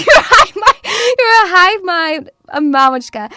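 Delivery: loud teasing chant; loud mock taunt. Mamushka taunting her pups, loud and gleeful.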